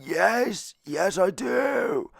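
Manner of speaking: high, drawn-out put-on voice; woman's eager voice. Woman confirming she works for money, high mock wail. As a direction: imitate her with stretched, high-pitched words.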